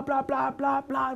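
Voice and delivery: ordinary talk, nothing marked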